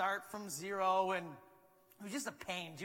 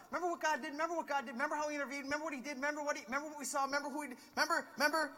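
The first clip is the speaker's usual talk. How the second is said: higher, excited voice; eager reminding; 8 semitones higher, much faster than usual. Pretend. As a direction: remind them eagerly, over and over. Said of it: "talking about".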